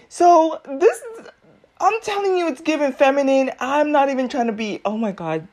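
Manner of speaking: normal talking voice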